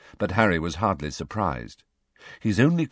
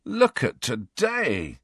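long, drawn-out groan of complaint; slow, dismayed moan; 6 semitones higher, much slower than usual. A narrator's reading. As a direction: groan the words slowly, pitched up. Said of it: "groaned Ron".

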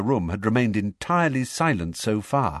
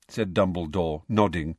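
ordinary delivery, nothing marked

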